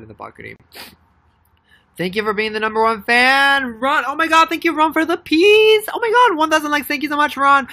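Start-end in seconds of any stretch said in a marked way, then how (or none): 2.0-7.7 loud, high gushing thank-you; excited thanks. Real, not acted. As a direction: gush over the number one fan. Thanking a viewer live.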